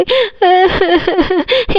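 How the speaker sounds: high staccato fake sobs; mock sobbing. Scolded wife crying over a saree, acted sobs. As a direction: sob in theatrical bursts. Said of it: "mummy कितना ज़्यादा रो रही है".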